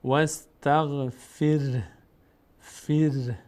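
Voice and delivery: واستغفر ending on a silent ر, said slowly; pausal form. Shown as a demonstration. Teacher shows the pausal form واستغفر, slow. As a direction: stop on واستغفر with no final vowel.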